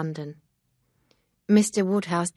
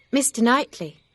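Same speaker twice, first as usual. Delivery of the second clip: higher, louder voice for a distressed Emma; Emma, upset young woman; 7 semitones higher, 7 dB louder than usual. A narrator's reading. Emma confessing her foolishness to Mister Knightley, higher and distressed.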